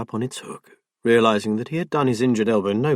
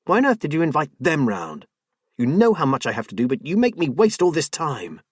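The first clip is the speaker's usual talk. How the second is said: sharp, raised exasperated voice; irritated wife; 5 semitones higher than usual. Acted. Wife snaps during quarrel.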